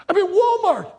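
high, loud exasperated reply; all upset. Retold or imitated. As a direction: shout back at your wife in a panic. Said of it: "and I'm all upset".

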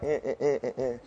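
soft, lazy half-hearted mumble with a laugh; mock half-hearted chant. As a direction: show them the too-cool-for-school non-effort. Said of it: "You can't just be like".

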